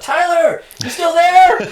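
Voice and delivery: high, loud call across the wind; yelling out. Retold or imitated. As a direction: holler to a friend far off, re-enacted. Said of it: "you had to yell out".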